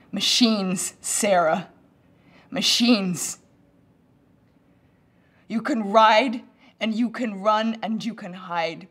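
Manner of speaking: loud, forceful cry of warning; full-voiced, urgent outburst; frantic warning. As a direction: shout it slowly, driving each word home. Acted frantic warning about killer machines.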